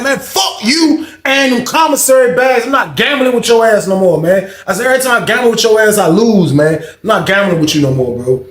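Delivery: loud, high, furious retold yelling at his cellmate; angry yelling. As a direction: bark it at him like you're done. Angry jail argument with cellmate.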